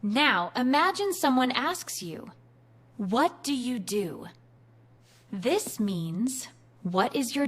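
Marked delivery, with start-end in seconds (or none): none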